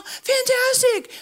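high, slow, drawn-out cheer; enthusiastic. Real, not acted. Pastor cheering on the rational listeners, high and drawn-out. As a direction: stretch out fantastic, beaming approval.

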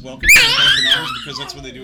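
loud, high, fast gush; excited outburst. Real, not acted. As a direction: blurt it out thrilled.

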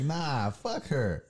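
loud, high, drawn-out mock defiance; defiant refusal. Acting out his own head refusing to apologize, defiant. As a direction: play the stubborn inner voice, dragging it out loudly. Acts out stubborn inner voice refusing.

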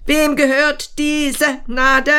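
high sharp interrogating question; like a prosecutor. Retold or imitated. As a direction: grill him like a prosecutor. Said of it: "Wie ein Staatsanwalt hat sie mich gefragt".

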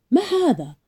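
mild narrated shout, pitched high; shouted demand. A narrator's reading. Policeman confronts her with the papers, mild high shout. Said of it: "رماها أمامي وصاح".

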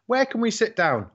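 high customer voice, mimicked; mimicking a customer. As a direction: squeak it like a clueless customer. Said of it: "get the questions asked in the pub".

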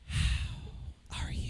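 breathy slow whisper mocking her; mock ASMR whisper. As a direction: whisper it slowly, teasing.

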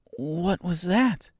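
small, high, slow and soft question; timid. A narrator's reading. Dorothy startled by a groan, timid and hushed. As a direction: ask as if half afraid of the answer. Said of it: "she asked timidly".

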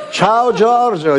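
very high, slow, drawn-out greeting; mock the hostess's sugary on-air hello; gushing TV hello. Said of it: "ma il loro modo".

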